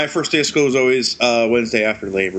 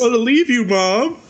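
put-on whiny kid; 12 semitones higher than usual. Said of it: "He's like".

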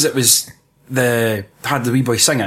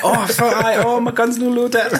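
high, laughing put-on panic; mock panicky excuse; 10 semitones higher than usual. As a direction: blurt out a feeble excuse, half laughing. Acting out policemen making excuses.

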